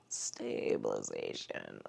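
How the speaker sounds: "Stabilization" repeated as a faint, breathy whisper; breathy echo. Shown as a demonstration. Instructor echoing the cue to herself, barely audible. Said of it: "says the cue word twice, differently".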